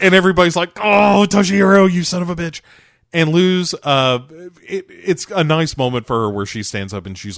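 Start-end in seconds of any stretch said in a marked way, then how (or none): 0.8-2.6 high, loud exasperated exclamation; mock group outcry. Everybody scolding Toshihiro for his remark, exasperated mock outcry. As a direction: cry out in hammed-up exasperation.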